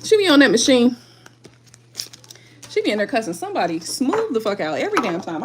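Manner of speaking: ordinary talk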